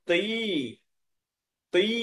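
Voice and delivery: তুই said apart with a long final vowel; shown pronunciation. Shown as a demonstration. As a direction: hold the ending of তুই. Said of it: "উচ্চারণ হবে।".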